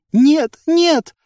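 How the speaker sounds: very high, loud cries; desperate cry. A narrator's reading. Village head tries to stop her fighters, desperate cry. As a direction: cry out in alarm.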